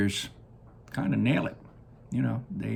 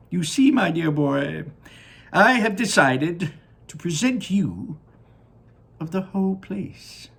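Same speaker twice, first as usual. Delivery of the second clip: Willy Wonka voice; 9 semitones higher, 7 dB louder than usual. Acted. Wonka gazing down at his factory, high and delighted. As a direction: sing it out like a proud candy maker.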